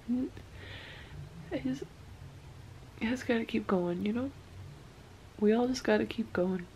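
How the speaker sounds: quiet, tearful voice pushing on; softly crying. Real, not acted. To viewers, choking back real tears, soft and tearful. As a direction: hold back the sobs while you speak. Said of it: "I'm done crying".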